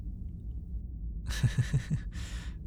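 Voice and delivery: quiet breathy little chuckle; soft giggle. Acted. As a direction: giggle softly from being tickled. Ticklish after a kiss.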